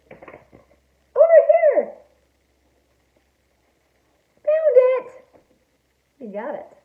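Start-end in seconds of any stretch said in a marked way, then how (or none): 0.1-5.1 high, loud excited shout; triumphant discovery. A narrator's reading. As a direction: shout that the search is over. Shark finds the toy.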